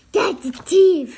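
loud high yell; shouting. Acted. Kipper shouting at everyone chasing the dog. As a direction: yell it at the top of your lungs. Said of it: "shouted Kipper".

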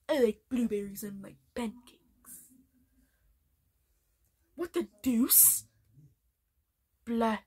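lightly voiced snooty cartoon baby; a hint of Stewie's haughty villain tone; Stewie Griffin. Fan doing Stewie Griffin for viewers, lightly voiced.

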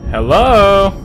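normal talking voice

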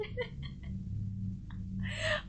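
breathless laugh. Real, not acted.

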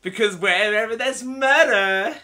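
grand, dramatic TV detective voice; posh actress voice. Acted. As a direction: play a vain star detective, loud and lofty. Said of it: "you need to think of a voice for that".

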